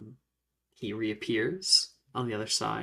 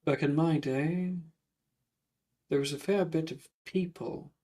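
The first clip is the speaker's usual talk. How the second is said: lightly voiced old-world gentleman, pausing between phrases; measured reminiscence in a ghost's character voice; reminiscing ghost; 3 semitones higher, 3 dB quieter than usual. Ghost telling students about magic in his day, reminiscing.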